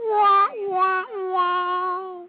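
high, imitated losing-game horn; sad-trombone wah-wah. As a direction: wail a mock failure jingle. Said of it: "Amanda gets a wah".

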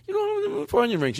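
high, fast girly imitation of the date; squeaky mocking impression of her; mock girl voice. Friend mocking his date in a high girly voice. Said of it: "she was".

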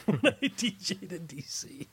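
amused, laughing at his own DJ past. Real, not acted. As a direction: chuckle your way through the line. Laughs at annoying people as DJ.

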